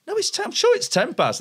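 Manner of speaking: high-pitched retold protest; mock insistence. Comic arguing with the tech over show times, mock insistence.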